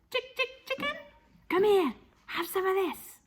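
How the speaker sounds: high, sing-song chick-calling; coaxing the chicken. Acted. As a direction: lure the hen over sweetly. Coaxing the hen.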